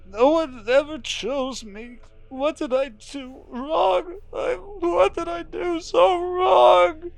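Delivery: high, slow, rising to a cry; sobbing man. Acted. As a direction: plead through sobs, building to a shout. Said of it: "tense words tumble out between muffled, uneven sobs".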